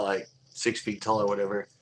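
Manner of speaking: ordinary talk, nothing marked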